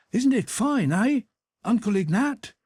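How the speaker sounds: put-on high, bright boy's voice calling out; eager boy. A narrator's reading. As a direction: play the cheeky young page boy. Boy calls to the porter.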